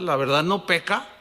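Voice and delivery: ordinary talk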